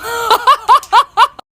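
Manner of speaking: high, loud burst of laughter. Real, not acted. Mom laughing after telling the Starbucks story, loud and high. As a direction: let a big laugh out.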